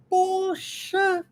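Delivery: drawn-out, high exclamation of amazement; slow, astonished exclamation; surprised. Shown as a demonstration. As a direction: stretch the words out in surprise. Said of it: "num sinal de espanto, né, de surpresa".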